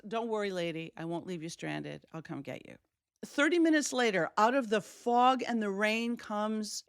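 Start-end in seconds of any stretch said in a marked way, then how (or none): 0.1-2.8 calm a stranded caller down; reassuring tow man. Retold or imitated. Tow man to stranded caller, soft reassuring voice.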